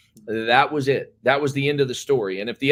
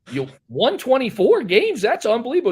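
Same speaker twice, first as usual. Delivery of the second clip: high, amazed question from the booster; mock-astonished booster, pitched up; 9 semitones higher, 4 dB louder than usual. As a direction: gush like you can't believe it.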